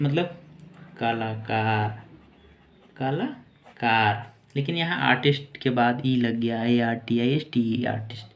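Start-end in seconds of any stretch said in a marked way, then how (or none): 1.0-4.3 drawn out very slowly, twice; slow dictation. Shown as a demonstration. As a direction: say it slowly for writing, syllable by syllable. Teacher dictating the meaning. (बहुत धीरे, लिखवाते हुए)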